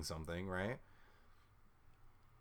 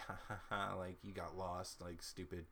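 mock taunting laugh; 7 dB quieter than usual. Mocking inner voice laughing at him.

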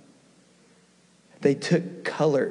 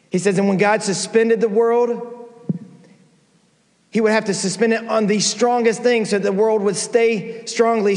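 higher, louder full-force preaching; impassioned preaching; 7 semitones higher, 4 dB louder than usual. Acted. Pastor preaching to his congregation about nothing, at full force. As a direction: build to a ringing climax from the pulpit.

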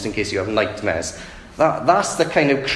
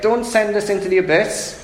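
begging; 9 semitones higher than usual. Acted. Demons begging Jesus.

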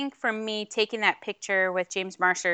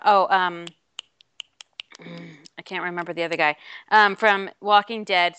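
ordinary talk

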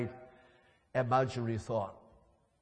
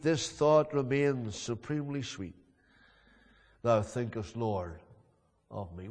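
hymn recitation; 2 semitones lower than usual. Acted. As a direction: recite the old hymn gently, almost prayerful. Quotes an old hymn, recited.

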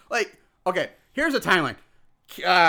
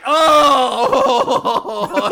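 loud, long, raised groan; exasperated groan; 5 semitones higher, 7 dB louder than usual. Real, not acted. Host hates this movie, long exasperated groan. Fed up with the film.

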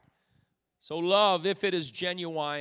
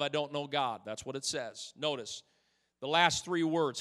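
ordinary delivery

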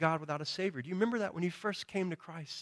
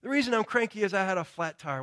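louder, higher hammed-up excuse voice; mock excuse; 5 semitones higher, 6 dB louder than usual. Preacher imitates a churchgoer blaming his flat tire, mock whiny.

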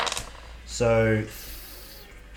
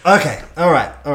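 excited; 4 semitones higher, 12 dB louder than usual. Real, not acted. Excited before sneak attack roll.

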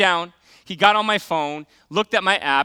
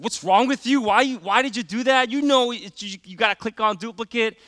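agitated scolding, higher pitched; retold yelling; 4 semitones higher, 3 dB louder than usual. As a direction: lightly re-enact a parent yelling at his son. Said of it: "I started yelling at him".

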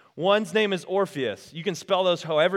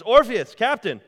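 higher, raised crewman's call; urgent call; 4 semitones higher, 7 dB louder than usual. Retold or imitated. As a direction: hail the captain with urgency. Crewman urgently calls his captain.

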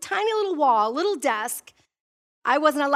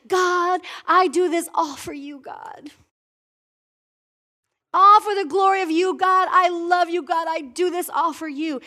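fervent prayer; 5 semitones higher than usual. Retold or imitated. Retold prayer to God, fervent.

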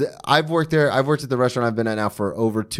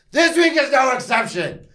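announcer intro; 10 semitones higher, 4 dB louder than usual. Acted. Host announcing the guest.

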